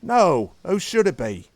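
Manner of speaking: a lightly voiced drunk in the crowd; indignant retort. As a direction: bark back at the thug.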